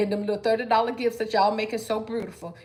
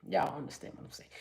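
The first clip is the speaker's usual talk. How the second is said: hushed, unvoiced confiding to the viewers; whispered aside; 5 semitones lower, 12 dB quieter than usual. Real, not acted.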